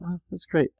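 high, soft "oh, that's right" wonder; acted delighted remembering, light and high; pleased sudden realization. Host replaying remembering forgotten money, pleased realization. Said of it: "I love the, the feeling of".

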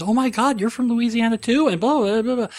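high-pitched gushing imitation of an excited girlfriend; squealy mock excitement, trailing into blahs. Retold girlfriend's excited recognition.

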